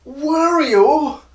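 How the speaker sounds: very high, loud cartoon cry; shrieky video game character voice; Wario. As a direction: squeal it out, stretched and slow. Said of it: "Can't do his accent".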